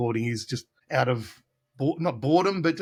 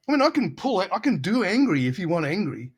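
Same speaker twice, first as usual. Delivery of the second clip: ordinary delivery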